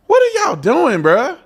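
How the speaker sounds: high, exasperated disbelief. Real, not acted. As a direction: throw the question up in exasperation. Exasperated at the new logo.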